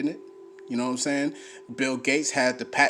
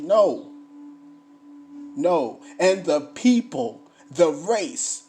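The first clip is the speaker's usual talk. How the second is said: loud, emphatic scripture reading; booming pulpit delivery; fiery preaching; 5 semitones higher, 5 dB louder, slower than usual. Acted. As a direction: preach it to the congregation.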